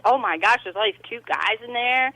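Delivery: ordinary delivery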